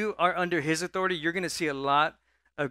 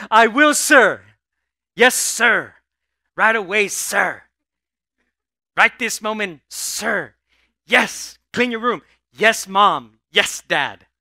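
loud, higher, overeager yes-sir; eager obedient son; 4 semitones higher, 12 dB louder, slower than usual. Retold or imitated. Son answering his father, loud and overeager. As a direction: snap to attention like a too-eager child. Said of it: "And the son answered".

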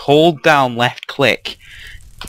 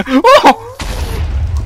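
high loud startled oh; startled shout; 5 dB louder than usual. Real, not acted. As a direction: yelp it high in surprise. Sudden scare.

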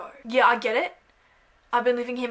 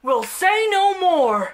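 громкий рекламный голос, медленно; mock ad pitch; 3 semitones higher, 8 dB louder than usual. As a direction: sell yourself like a TV ad.

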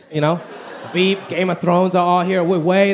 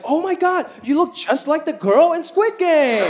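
higher, slow fan voice; excited fan; 8 semitones higher, slower than usual. Retold or imitated. A stranger at Halloween gushing over the costume, higher voice. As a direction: gush at the costume. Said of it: "he's like".

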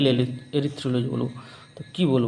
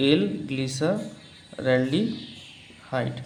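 slow dictation; 6 dB quieter, much slower than usual. Shown as a demonstration. Teacher dictating a compound name to students, very slow. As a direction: stretch every syllable very slowly. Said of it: "কী লিখবো?".

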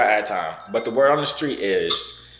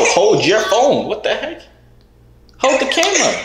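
higher and louder, getting more exasperated; rising disbelief at the mix-up; 12 semitones higher, 5 dB louder than usual. Real, not acted. Exasperated at the girl holding the wrong device. Frustrated instructions, pitch climbs.

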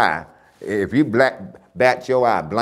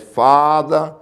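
raised, higher call of Father; loud cry; 7 semitones higher, 6 dB louder than usual. Acted. Jesus on the cross calling to his Father, loud cry. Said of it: "had cried with a loud voice".

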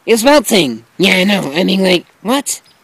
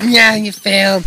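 ordinary talk

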